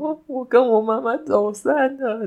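put-on high, childlike voice of a lost kid; little lost boy. Little boy tells the kind aunt he lost his mum, in a high childlike voice. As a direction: play the small boy telling a stranger he's lost. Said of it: "我可能就说".

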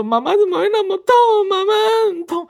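put-on high, fast little-kid whimper; childish wail to mom; crying child voice. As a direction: play yourself as a small child in pain. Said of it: "我说".

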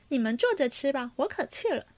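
usual speaking voice

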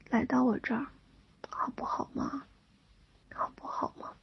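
put-on soft childish begging whisper; cutesy whiny pleading. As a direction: wheedle like a spoiled little kid. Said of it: "还有一点点撒娇的感觉".

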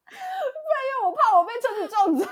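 high, loud words pushed out through laughter; laughing confession. Real, not acted. Telling a friend about the crash, laughing it out loud and high. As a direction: laugh it out helplessly.

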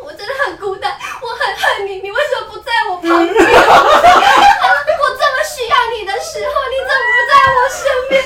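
soap-opera wail, half-laughing; imitating a drunk friend crying; mock weepy drama. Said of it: "他就说".

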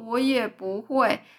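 slow, plaintive little wail. Acted. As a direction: moan it out sadly. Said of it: "萤火虫哀嚎说". (哀嚎着慢慢说)

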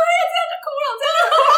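high, mocking singsong of a teasing mother; mother teasing her crying daughter, high and mocking; teasing mom. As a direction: poke fun at the crying kid in a high voice. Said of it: "还说".

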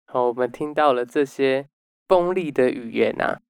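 ordinary delivery

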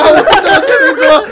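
loud, high, laughing realisation; he just let a real name slip, so he laughs loudly; laughing in alarm. Real, not acted. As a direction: blurt it out through a burst of laughter.

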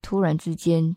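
ordinary talk, nothing marked

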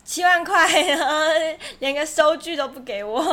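loud, indignant outburst; ‘七万块’ said loudly in disbelief. Real, not acted. Outraged over no receipt.

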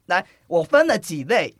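ordinary talk, nothing marked

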